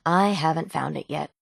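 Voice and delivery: ordinary talk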